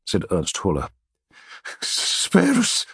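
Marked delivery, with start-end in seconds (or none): 1.8-2.9 frightened, high stammered plea; beg, stumbling over the words; stammering. A narrator's reading. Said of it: "Marcus stammered".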